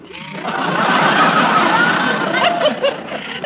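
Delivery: high breathy society titter; tittering laugh. Acted. Amused lady answering Molly's basket story with a high titter. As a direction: titter politely at the tale.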